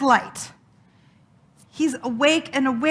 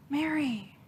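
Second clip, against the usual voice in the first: gentle call; 9 dB quieter than usual. Acted. The risen Jesus calls her name.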